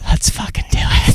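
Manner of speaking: eager whispered answer. Pretend. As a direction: whisper it back, keen. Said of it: "pretend you're in a nightclub".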